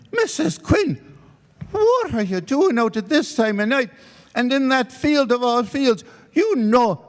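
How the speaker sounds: high, fussing reproach; scolding neighbour. Acted. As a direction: chide her for walking that field at night. Mick scolding woman in field.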